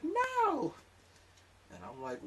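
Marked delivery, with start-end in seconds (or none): 0.0-0.7 very high squeaky no; indignant girly squeak; girlfriend voice. Retold or imitated. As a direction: girlfriend denying it. Girlfriend denies.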